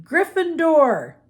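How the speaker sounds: hat shouting. A narrator's reading. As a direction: announce the house with a big shout. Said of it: "the hat shouted".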